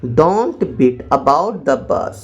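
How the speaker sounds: very slow, word by word; slow dictation. Shown as a demonstration. Teacher dictating to students, very slow. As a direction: spell it out slowly for learners. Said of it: "मत हाँको को बोल देंगे ऐसे".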